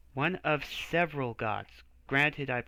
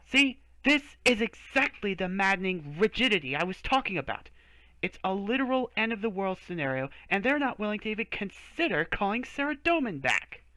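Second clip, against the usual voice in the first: higher, louder exasperated rant; 6 semitones higher, 3 dB louder than usual. Acted. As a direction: let the frustration boil over. Frustrated rant at rigidity.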